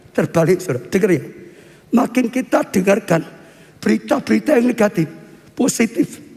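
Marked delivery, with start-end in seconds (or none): none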